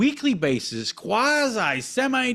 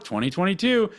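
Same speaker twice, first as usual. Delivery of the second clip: normal talking voice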